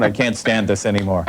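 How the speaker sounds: ordinary talk, nothing marked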